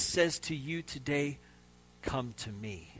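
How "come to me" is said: soft, much quieter, gentle; tender invitation. Acted. Preacher voicing Jesus to exhausted listeners, soft and tender. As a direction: say it like a hand on a tired shoulder.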